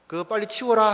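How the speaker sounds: loud, pushy nagging order; mock yell. Parent nagging a child to tidy up, mock yell. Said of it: "막 이렇게 소리칩니다".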